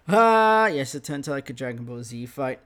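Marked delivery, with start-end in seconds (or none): 0.1-0.7 loud sharp Ha as he powers up; powering-up shout. Retold or imitated. Demon rival powering up for the fight, loud battle shout. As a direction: bark out a battle cry.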